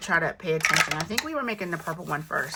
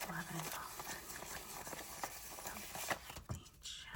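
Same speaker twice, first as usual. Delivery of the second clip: much softer, breathy whispered count; 2 semitones lower, 22 dB quieter than usual. Real, not acted. Recounting the money after losing track, whispered. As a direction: count under your breath to focus.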